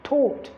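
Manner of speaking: "taught" with a long open O, /tɔt/; "taught" spoken slowly, showing its long O. Shown as a demonstration. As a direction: say "taught" with a long, rounded O vowel. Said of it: "the sound that is realized here is the long O sound".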